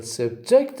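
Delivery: "subject" with the weight on JECT; stress on second syllable. Shown as a demonstration. Teacher gives an example with the verb, stress on JECT in "subject". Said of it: "stress on the second syllable".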